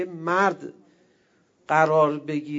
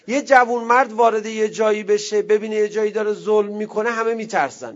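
rousing sermon; 4 semitones higher, 6 dB louder than usual. Acted.

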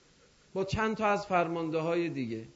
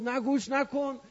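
higher pitched, frantic plea of a doomed man; a pleading mock shout, voice pitched up high; desperate cry; 6 semitones higher than usual. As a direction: cry out to stop him listening.

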